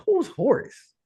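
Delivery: high, baffled who's Horace; a confused newcomer's high question; puzzled question. Retold or imitated. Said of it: "I was like".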